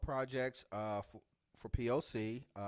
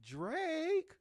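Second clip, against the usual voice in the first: rapper voice; 6 dB louder than usual. Acted. Mocking [name] again.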